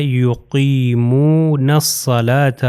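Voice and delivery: یقیمون الصلاۃ drawn out slowly as "yuqeemoonas-salaata"; یقیمون الصلاۃ in measured recitation, noon into a doubled heavy s; noon joined to saad. Shown as a demonstration. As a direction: recite یقیمون الصلاۃ slowly with long vowels, lam silent. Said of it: "یہ نون جو ہے".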